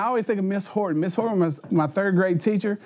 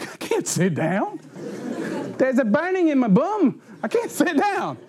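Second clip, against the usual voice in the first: put-on slow, pained kid whine, rising higher; hammed-up whine of a kid with a sore bum; sore kid whining; 5 semitones higher than usual. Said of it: "You're like".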